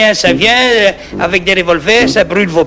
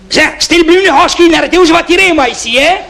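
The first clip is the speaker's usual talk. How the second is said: threatening call; 10 semitones higher than usual. Acted. Threatening the intruder with gun.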